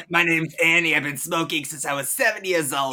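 lightly put-on raspy smoker voice. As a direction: play a chain smoker, laughing. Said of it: "I sound like a smoker".

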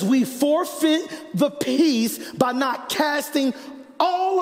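high, slow, ringing preacher's delivery; full-force sermon declamation; emphatic repeat. Real, not acted. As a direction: drive each phrase home, stretched out. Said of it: "Let me say that again for you".

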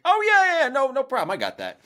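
high, loud overeager yes; eager reassurance. Retold or imitated. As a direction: brush it off, too keen. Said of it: "I was like".

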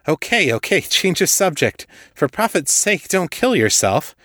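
high-pitched urgent reassurance; alarmed hurry. A narrator's reading. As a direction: talk him down fast before he hurts himself. Kate alarmed, calming Hal.

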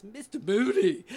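high, loud, drawn-out comic cry of a boss's name; Lucille Ball. Acted. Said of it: "he reminded us of Lucille Ball".

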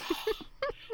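sharp high breathy gasp, mocking. Co-host acting out the brothers' reaction, mock gasp.

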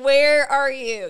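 loud, slow Where are you; slow loud mock demand; mock exasperated call. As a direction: call out like a baffled mom. Moms asking where mother was.

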